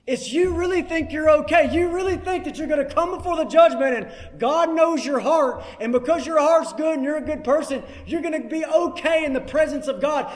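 fervent sermon warning; preaching. Acted. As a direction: press the warning on the congregation. Preaching at full force.

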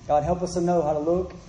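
soft, low pastoral prayer; hushed, reverent prayer voice; closing prayer. Acted. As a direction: pray quietly over the congregation.

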